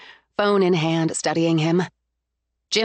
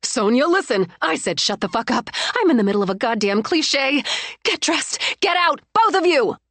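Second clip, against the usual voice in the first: furious shouting; 6 semitones higher than usual. Acted. Betrayed woman ordering cheaters out, furious shouting. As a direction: bark them out of the house.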